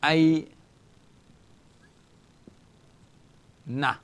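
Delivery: "ay" then "na" with long pauses between; "ay na" drawn out syllable by syllable. Shown as a demonstration. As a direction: split "ay" and "na" slowly for students. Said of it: "So it's the same letter noon".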